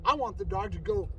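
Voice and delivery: usual speaking voice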